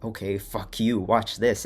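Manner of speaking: fired-up recalled defiance; defiant. Retold or imitated. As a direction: throw it out as a loud challenge. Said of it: "flipped a switch in me where I was like".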